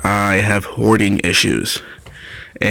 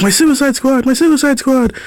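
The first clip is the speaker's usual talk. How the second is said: much higher, gushing repeat; excited geeking out. Retold or imitated. Telling anyone about his team, high excited gush. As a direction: squeal it like a fan geeking out. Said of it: "I'm like".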